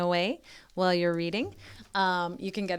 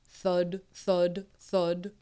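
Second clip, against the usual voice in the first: low steady thud thud thud; dull thuds; 3 semitones lower, much slower than usual. Acted. Apples dropping in the harvest, low dull thuds. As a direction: let each apple land. Apples falling sound words.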